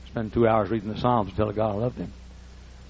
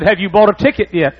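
much higher and louder; agent's voice; 9 semitones higher, 12 dB louder than usual. Retold or imitated. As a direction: fire it off as the baffled agent. Agent questioning at counter.

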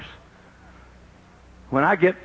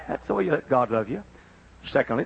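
ordinary delivery

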